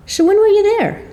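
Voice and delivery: high, curious question to the author; amazed question. Retold or imitated. Reader asking author where he saw the place, amazed. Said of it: "I had to ask".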